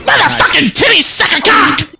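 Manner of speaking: high, loud yelled outburst; mock outburst. Friend blurts a random high yell.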